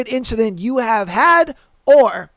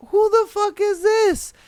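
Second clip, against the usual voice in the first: high, startled who-is-this; incredulous; 9 semitones higher than usual. Retold or imitated. Driver honked at, asking who this is, high and incredulous. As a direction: sound baffled and put out. His own retold reaction.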